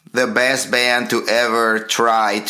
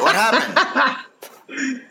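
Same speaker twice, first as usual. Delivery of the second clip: laughing; 13 semitones higher, much slower than usual. Real, not acted. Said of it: "laughing at pause".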